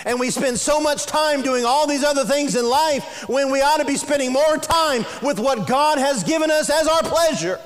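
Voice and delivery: loud, high, driving sermon voice; rising preacher's fervor; fiery preaching. Real, not acted. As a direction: preach it hard and build. Preaching at full force.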